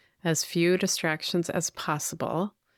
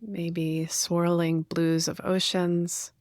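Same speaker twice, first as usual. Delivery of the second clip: guided meditation. Real, not acted. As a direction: gently guide listeners inward, leaving space to breathe.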